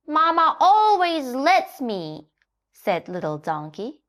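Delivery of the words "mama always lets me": giggly, cheeky high voice; giggling. A narrator's reading. Donkey giggles back at the scolding hen. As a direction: laugh while you say it.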